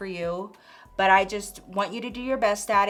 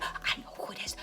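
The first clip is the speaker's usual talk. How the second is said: whisper; 13 dB quieter than usual. Retold or imitated. Gossip in office so nobody hears.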